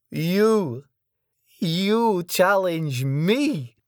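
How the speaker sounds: very high, very slow mocking challenge; incredulous foreign brute. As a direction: drag it out in disbelief. Sergei amused menace.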